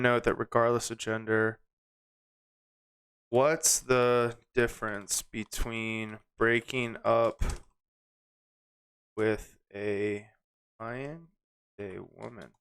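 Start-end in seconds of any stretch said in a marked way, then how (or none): 3.3-12.5 slow, halting, trailing away very soft; typing aloud. Real, not acted. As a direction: murmur it slowly as you type. Types the question while saying it.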